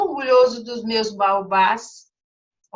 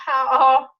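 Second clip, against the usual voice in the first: a short real laugh; teacher laughing at the story; 3 semitones higher, 5 dB louder than usual. Real, not acted. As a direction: laugh at the funny line. Laughs after a funny line.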